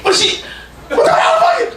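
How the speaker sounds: high, laughing disbelief; stunned. Real, not acted. Comic thrown by a heckler, high laughing shock. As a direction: squeak out your shock.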